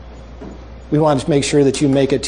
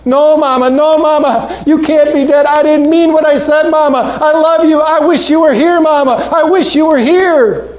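high, loud, sobbing plea to her mother; crying wail; 13 semitones higher, 5 dB louder than usual. Acted. Girl weeping over her mother's casket, high sobbing plea. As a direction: cry it out as a broken-hearted girl. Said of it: "she began crying".

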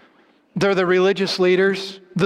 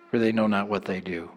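much lower, much softer and quick, a whispered plea; hushed prayer; 12 semitones lower, 7 dB quieter, faster than usual. Acted. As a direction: let "for they know not what they do" fall low and soft. Quoting Jesus' prayer, hushed.

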